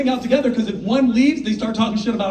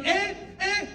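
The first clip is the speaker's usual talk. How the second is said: higher, sing-song auntie greeting; cheery aunts; 7 semitones higher, 3 dB quieter than usual. Retold or imitated. Aunties greeting him at the door, high and cheery. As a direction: gush like delighted aunties. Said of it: "the Amis are like".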